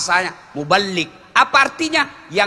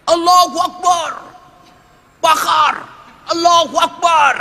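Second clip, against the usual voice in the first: much higher, louder chanting yell; mob shout; 12 semitones higher, 5 dB louder than usual. Retold or imitated. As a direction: bellow like a rioting crowd. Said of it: "Teriak dia".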